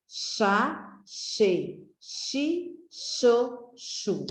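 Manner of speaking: each syllable said slowly and separately: chá, che, chi, cho, chu; syllables sounded out. Shown as a demonstration. Teacher sounding out syllables for children, slow and separate. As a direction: sound out the syllables one by one for the class. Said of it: "o som das sílabas".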